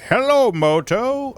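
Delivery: high, slow sing-song ringtone voice; phone ringtone imitation. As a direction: mimic the phone's startup jingle. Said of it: "really fucking loud".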